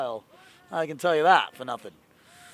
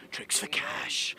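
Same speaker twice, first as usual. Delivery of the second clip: whisper; 4 semitones lower, 8 dB quieter, slower than usual. Shown as a demonstration. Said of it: "same words whispered next".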